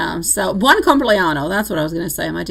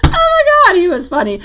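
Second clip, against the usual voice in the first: excited; 11 semitones higher, 7 dB louder than usual. Real, not acted. Laughing recall of funny guy.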